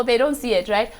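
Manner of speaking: normal talking voice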